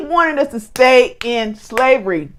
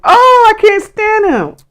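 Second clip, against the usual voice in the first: exasperated venting; 8 semitones higher than usual. Real, not acted. Venting to co-host about the devil, exasperated. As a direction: let the disgust burst out high and strained.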